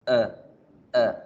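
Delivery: short isolated uh for the "eh"; brief relaxed uh, mouth barely open; schwa sound. Shown as a demonstration. Teacher isolating the schwa ending, short weak uh. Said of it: "por el sonido de la schwa".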